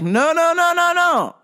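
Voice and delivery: high, rapid-fire string of noes; hasty refusal. Retold or imitated. Husband stopping wife from waiting, high urgent refusal.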